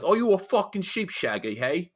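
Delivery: high-pitched mock British lad; strong British accent. Imitating lads from outside London, strong British accent. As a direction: put on a thick regional British accent. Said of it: "That's where they have, like, the really strong".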